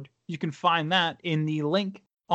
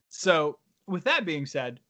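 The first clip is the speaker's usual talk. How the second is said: ordinary talk, nothing marked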